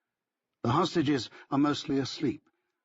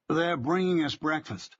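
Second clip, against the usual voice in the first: higher-pitched, lightly Minnesotan vowels; Minnesotan accent; 5 semitones higher than usual. A narrator's reading. Telling Francisco about breakfast, light Minnesotan accent. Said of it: "bending my Minnesotan vowels this time".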